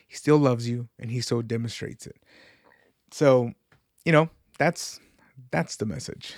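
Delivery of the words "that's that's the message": whisper. Acted. Sharing his message with listeners, in a whisper. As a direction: let the last words fall to a whisper.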